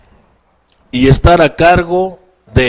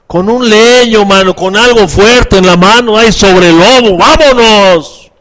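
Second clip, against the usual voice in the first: loud, high, charging at the wolf; forceful rallying shout; 6 semitones higher, 6 dB louder than usual. Acted. Preacher showing how to go at the wolf, shouting forcefully.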